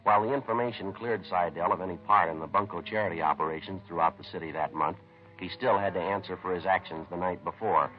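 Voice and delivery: measured, matter-of-fact detective narration over music; steady procedural voice-over telling the investigation; crime story narration. A narrator's reading. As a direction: report the case like a tired police sergeant. Police narrator recounts the case.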